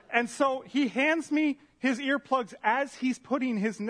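usual speaking voice

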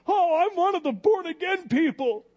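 high, loud, excited new believer voice; mock naive convert. Preacher recalls his younger self, giddy and loud.

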